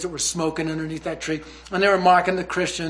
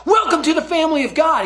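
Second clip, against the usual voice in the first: put-on overexcited kid's high, loud shout; Josh; 10 semitones higher, 7 dB louder than usual. Kid welcoming new believers, freaking out with excitement. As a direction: imitate a kid bursting with joy. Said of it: "Josh freaks out".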